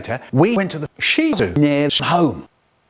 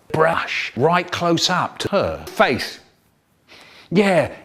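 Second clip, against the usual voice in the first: ordinary talk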